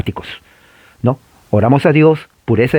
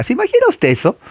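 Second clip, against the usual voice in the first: amazed; faster than usual. Real, not acted. Teacher marvels at armed believers.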